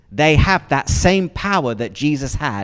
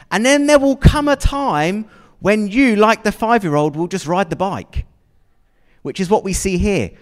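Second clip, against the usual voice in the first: normal talking voice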